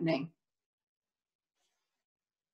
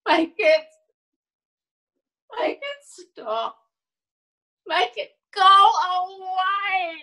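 desperate begging; 6 semitones higher than usual. Acted. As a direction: beg for it to end. Acted begging for it to end.

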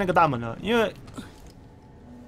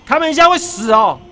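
loud, high, thrilled cry; excited shout; 5 semitones higher, 9 dB louder than usual. Real, not acted. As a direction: shout it out gleefully. Shoots androids, excited. (兴奋大喊)

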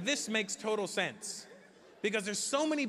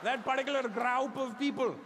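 Indian man; 7 semitones higher than usual. Retold or imitated. Indian man pointing answer.

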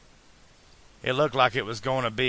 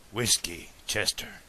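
quiet, grave repeat; solemn; 6 dB quieter than usual. A narrator's reading. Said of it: "he repeated solemnly".

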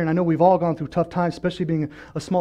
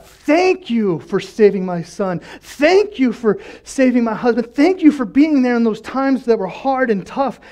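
stretched, emphatic gratitude, building higher; crying out thanks; 3 semitones higher, slower than usual. Acted. Believer thanking God for saving family, fervent cry. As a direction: draw out each thank you fervently. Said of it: "in the good times we cry out to God".

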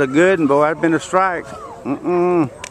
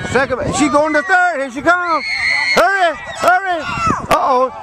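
excited yelling; 12 semitones higher, slower than usual. Real, not acted. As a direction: scream the runner home. Cheering a home run.

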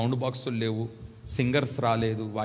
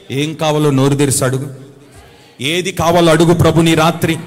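loud preaching; 13 dB louder than usual. Real, not acted. Preacher urging the congregation to ask boldly, loud. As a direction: urge them on loudly, stretch the last amen.

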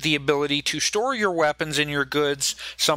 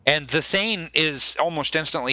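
usual speaking voice

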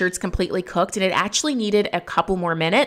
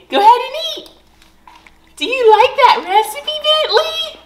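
excited dog talk; 5 dB louder than usual. Real, not acted. Owner hypes her dogs before dinner, high squeaky baby talk. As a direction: gush at the dogs like they're toddlers.

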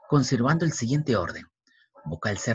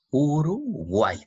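slow, higher "Uruguay", uru, pause, guay; syllable by syllable; 4 semitones higher, much slower than usual. Shown as a demonstration. Teacher showing the final Y, "Uruguay" slowly split. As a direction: draw out "Uruguay" in two separate parts.

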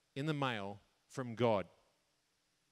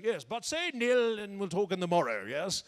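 high, loud mock-Shakespearean actor; 5 semitones higher, 5 dB louder than usual. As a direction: declaim it like a hammy stage thespian. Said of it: "It sounds very Shakespearean".

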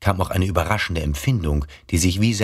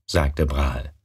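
ordinary delivery

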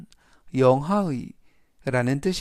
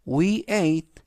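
We ate seven portions of beef, read very slowly; slow classroom model sentence; slow teaching read; 3 semitones higher, much slower than usual. Shown as a demonstration. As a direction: read it slowly for students to repeat. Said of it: "천천히 세 번씩 읽어드리고요".

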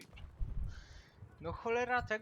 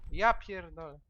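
ordinary delivery

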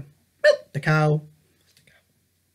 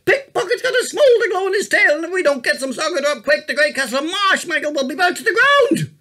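louder, higher and fast, breathless urgency; urgent alarm; 9 semitones higher, 4 dB louder, faster than usual. A narrator's reading. Boy wakes his grandfather in a panic, urgent and fast. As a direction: blurt it all out in a rush.

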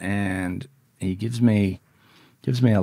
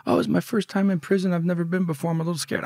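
higher-pitched voice of a green first-timer; naive scared kid; 10 semitones higher, faster than usual. Retold or imitated. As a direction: imitate a nervous kid opening up. Naive kid's reply, retold.

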